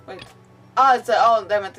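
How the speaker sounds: ordinary talk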